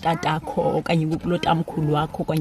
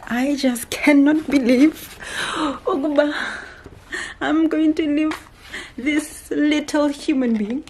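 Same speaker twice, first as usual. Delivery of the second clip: tender sadness; 7 semitones higher than usual. Real, not acted. Sad to leave her baby.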